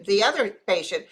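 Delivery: ordinary talk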